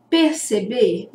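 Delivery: ordinary delivery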